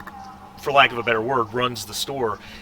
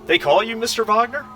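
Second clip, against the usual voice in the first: higher, lightly voiced questioning clerk; curious shopkeeper; 8 semitones higher than usual. Store clerk looks up at a customer, curious question. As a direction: look up and ask, lifting the pitch at the end. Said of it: "He kinda looks up and says".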